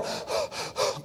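breathy panting gasps, acted out; imitated sheep gasp. Preacher mimics the overturned sheep to the congregation, mock gasping. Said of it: "turn over and go".

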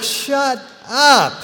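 father to gushing daughter, high mock exasperation; mock exasperated. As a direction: snap back at the silliness. Dad's reply to the gushing.

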